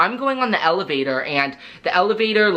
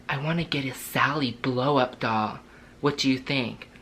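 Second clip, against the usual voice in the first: softer, lower murmur, mock creepy; hushed creepy talk; 5 semitones lower, 6 dB quieter than usual. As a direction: murmur it like a couple sharing a secret. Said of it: "whispering things".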